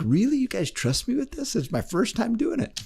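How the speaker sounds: startled and a little nervous; surprised disbelief. Retold or imitated. Son amazed his family agrees to hypnosis, surprised and high.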